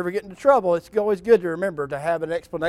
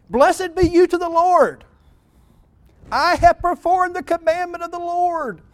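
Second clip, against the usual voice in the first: fawning Saul; 12 semitones higher, 6 dB louder than usual. Acted. Saul greets Samuel to dodge blame, high fawning voice. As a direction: lay the flattery on thick and high. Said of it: "Watch closely what Saul says".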